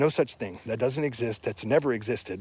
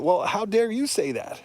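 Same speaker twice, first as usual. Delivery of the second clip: higher, pointed comeback; indignant retort; 7 semitones higher than usual. Real, not acted. Turning critics' own words back on them, indignant and raised. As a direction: fire the question back at them.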